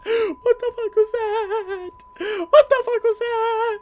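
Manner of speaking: slow, very high panicked whine; frightened wail. Real, not acted. Gamer hiding after being attacked, frightened wail.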